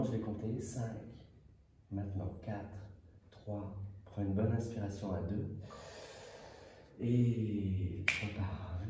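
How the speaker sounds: lulling, measured trance guidance; hypnosis induction. Acted. Leads a second hypnosis induction.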